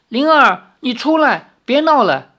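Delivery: high, loud, pleading call. Acted. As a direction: call to someone hiding nearby. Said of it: "李逍遥叫道".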